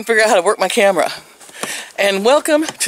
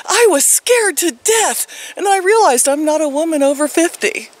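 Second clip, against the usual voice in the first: high-pitched retold fright, easing slightly; mock alarm; 9 semitones higher than usual. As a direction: retell a scare in a high, exaggerated voice. Retelling son's mock alarm.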